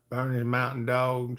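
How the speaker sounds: ordinary talk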